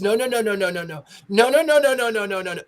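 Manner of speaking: high, loud frantic no's; panicked pleading. Retold or imitated. Contestant refusing to walk into the dark, panicked pleading. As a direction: plead in terror, voice climbing. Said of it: "she says".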